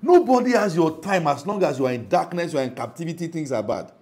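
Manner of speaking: ordinary delivery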